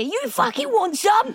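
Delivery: high, slow parody of a challenge; mock-aggressive echo in a put-on high voice; mocking imitation. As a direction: mimic his fighting talk, drawn out. Mimics his shouted challenge back.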